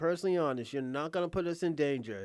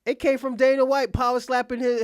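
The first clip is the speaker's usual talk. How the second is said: ordinary talk, nothing marked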